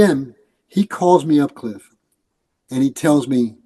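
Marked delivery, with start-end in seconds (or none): none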